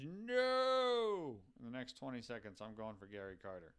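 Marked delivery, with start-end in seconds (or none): 0.0-1.4 sudden loud, high no; mock scream. Streamer imagining a chat viewer objecting, loud high mock yell. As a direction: yell it like a panicked fan stopping the deal. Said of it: "Unless somebody screams".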